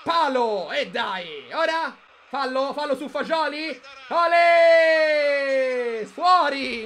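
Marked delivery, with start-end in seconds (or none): 4.1-6.8 cheering shout. Real, not acted. Cheering the crowd.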